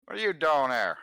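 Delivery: high loud flirty catcall; catcalling construction worker. Acted. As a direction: leer at them like a builder. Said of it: "he go".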